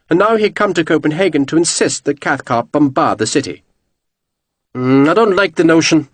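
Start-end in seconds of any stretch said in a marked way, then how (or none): 4.7-6.1 grumbling. A narrator's reading. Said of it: "Cathcart grumbled".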